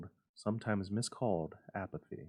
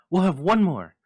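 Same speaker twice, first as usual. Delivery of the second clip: urgent; 8 semitones higher, 14 dB louder than usual. Acted. Triumphant wife urging.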